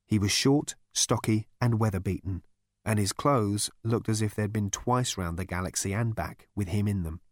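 ordinary delivery

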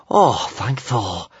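slow, sighed-out thanks; relieved sigh. A narrator's reading. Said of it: "sighed Kamikaze".

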